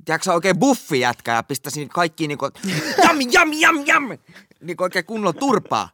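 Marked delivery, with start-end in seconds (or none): 3.0-4.2 high, loud "jam, jam, jam" punch sounds; punching noises. Retold or imitated. Boasting of buff dream punches, loud high punch noises. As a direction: bang out each "jam" like a hard punch.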